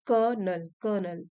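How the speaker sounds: "कर्नल" said as kur-nul, twice; pronunciation of colonel. Shown as a demonstration. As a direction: model "कर्नल" in two syllables, kur-nul, then repeat. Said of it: "हम इसको pronounce करते हैं". ("कर्नल" का उच्चारण, कर-नल, दो बार)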